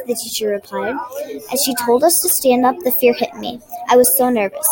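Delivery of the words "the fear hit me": plain reading voice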